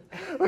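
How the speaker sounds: high loud laugh; burst of laughter. Real, not acted.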